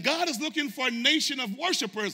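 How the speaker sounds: high, loud preaching of the line; shouting sermon line; preached cry. Shown as a demonstration. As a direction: proclaim it at full force. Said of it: "Painting a picture".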